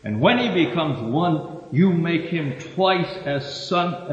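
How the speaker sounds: preacher proclaiming judgment; declaiming scripture. Acted. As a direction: thunder the woes at the Pharisees. Quotes Jesus' woes in Matthew.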